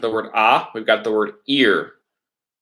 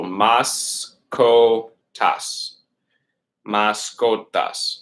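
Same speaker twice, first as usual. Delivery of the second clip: slow, syllables separated; much slower than usual. Shown as a demonstration. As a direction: sound it out piece by piece. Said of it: "take it syllable by syllable".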